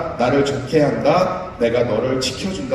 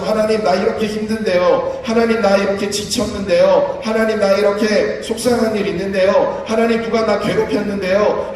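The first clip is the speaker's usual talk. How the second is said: pleading complainer; 7 semitones higher, 3 dB louder than usual. Acted. Voicing a complaining believer.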